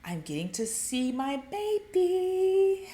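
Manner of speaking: high, drawn-out mock glee; acted giddy excitement, last word stretched; excited. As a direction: croon it like a giddy child. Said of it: "have that excitement".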